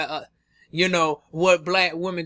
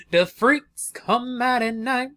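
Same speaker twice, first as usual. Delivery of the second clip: slow, drawn-out teasing spooky voice; slow mock-sinister tease; sultry spooky intro; 6 semitones higher, slower than usual. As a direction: croon it like a late-night hostess.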